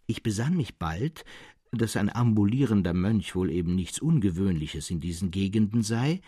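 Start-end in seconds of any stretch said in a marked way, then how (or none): none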